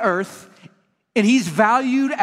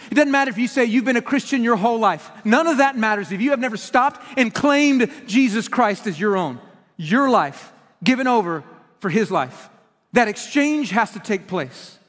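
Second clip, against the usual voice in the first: ordinary talk, nothing marked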